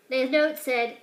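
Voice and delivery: ordinary delivery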